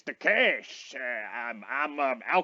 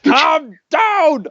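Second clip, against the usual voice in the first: shout; 12 semitones higher, 9 dB louder than usual. Acted. Player yells at screaming friend.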